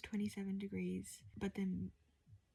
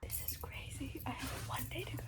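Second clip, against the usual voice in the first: late-night whisper; 4 semitones higher, 5 dB quieter than usual. Real, not acted. Packing at 3:49 AM.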